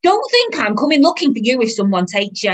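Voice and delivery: fed-up mother yelling; shouting. Acted. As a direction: shout a threat at a wayward child. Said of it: "the woman shouted again".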